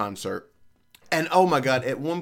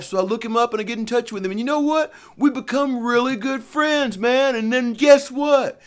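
much higher, louder mock rock star; a high mock pop star, louder; 10 semitones higher, 4 dB louder than usual. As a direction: ham up the singer boasting. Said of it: "He's like".

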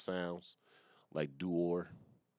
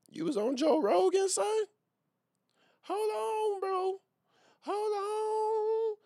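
squeaky high-pitched astonishment; shocked disbelief; 6 dB louder, slower than usual. Real, not acted. Reacting to a guest found on Joe Rogan, high shocked squeal. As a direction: squeal it like you can't believe what you're seeing.

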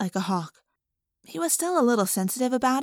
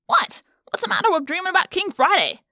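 much higher indignant demand; 9 semitones higher, 3 dB louder than usual. Acted. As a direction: Marco jumps up in pitch, offended. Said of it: "Marco demanded".

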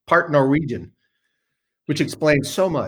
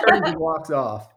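ordinary talk, nothing marked

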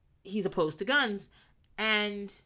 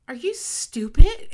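slow, louder, higher are you stupid; blunt loud question; 8 semitones higher, 13 dB louder, slower than usual. Retold or imitated. Glenn bluntly asking Amy, loud and slow. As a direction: spell it out to her.

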